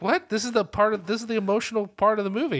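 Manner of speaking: pleading that this is the emotional part; exasperated high-pitched objection; incredulous protest. Retold or imitated. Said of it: "I was like".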